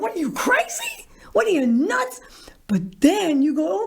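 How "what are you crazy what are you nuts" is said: incredulous. Retold or imitated. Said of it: "He's like".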